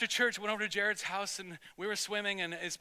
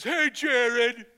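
loud, higher mock bully's yell; confrontational call-out; 4 semitones higher, 10 dB louder than usual. Bigger kid accosts two fifth graders, confrontational yell. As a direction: call him out like the big kid.

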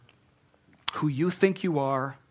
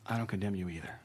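soft forgiving words; 6 semitones lower, 7 dB quieter, faster than usual. Acted. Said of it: "And he says".

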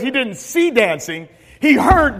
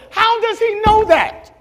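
higher, shouted sermon climax; preaching at full force; 5 semitones higher than usual. Acted.

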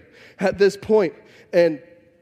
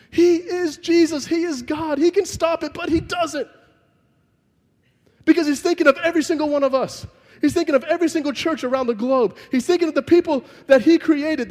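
much higher, driving preacher's cry; impassioned preaching; 7 semitones higher than usual. Acted. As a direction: preach it at full force. Preaching climax at full force.

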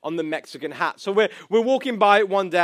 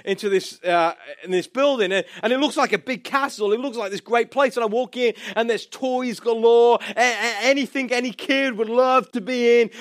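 ordinary delivery

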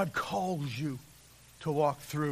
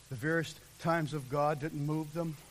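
ordinary delivery

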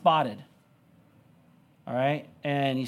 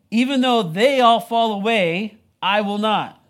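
loud, raised, slowed declaration; emphatic vow; 5 semitones higher, 7 dB louder, slower than usual. Acted. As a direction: vow it firmly, loud and high. Acting out Peter's bold vow.

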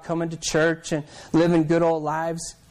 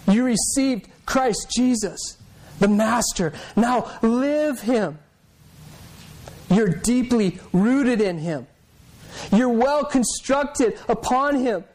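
slow, higher, deliberate reading of the verses; scripture reading; 5 semitones higher, slower than usual. Acted. Preacher reading the verses to the congregation, slow and higher. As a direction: let each phrase of scripture land slowly.

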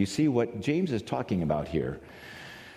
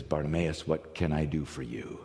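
softer, lower, tender asking; gentle question; 5 semitones lower, 5 dB quieter than usual. Retold or imitated. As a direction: speak to him kindly. Jesus speaks gently.

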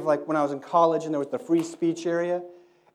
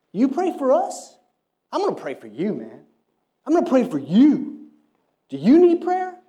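much higher, louder, taunting street voice; cocky attitude; 10 semitones higher, 10 dB louder than usual. Retold or imitated. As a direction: challenge the missionary with swagger. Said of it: "It's kinda just this attitude".

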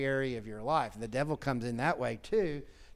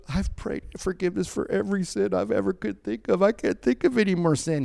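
put-on higher pleading old lady voice; desperate old woman; 6 semitones higher, 4 dB louder than usual. Pastor voices woman's anguish, higher and pleading. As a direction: beg like she has run out of sins. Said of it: "And she goes".

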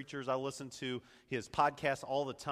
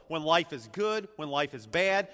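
ordinary talk, nothing marked

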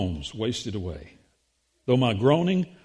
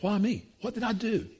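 Job's pleading cry, pitched much higher; anguished Job crying out; 7 semitones higher, 5 dB quieter than usual. Acted. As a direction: cry out to heaven, why me. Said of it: "he's asking".

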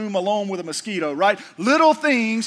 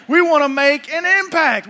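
raised and higher, driving the point home; preaching shout; 6 semitones higher, 6 dB louder than usual. Real, not acted. Preacher calls out to the congregation, loud and high. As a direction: proclaim it to the whole church.

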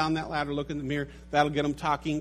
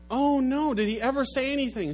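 alarmed shopkeeper; 8 semitones higher than usual. Acted.

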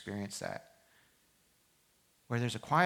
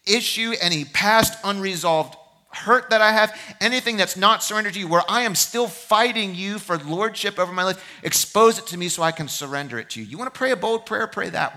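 loud, high, impassioned preacher's plea; preaching at full force; 9 semitones higher, 12 dB louder than usual. Acted. Pastor urging his congregation toward surrender, loud and high. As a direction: pour it out like a pulpit cry.

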